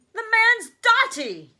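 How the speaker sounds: put-on high, slow, scornful old granny voice; grumpy old woman. Grandma scoffing at the news, high crotchety mutter. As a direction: sneer it like a cranky grandmother. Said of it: "muttered Grandma Josephine".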